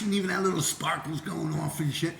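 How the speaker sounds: ordinary delivery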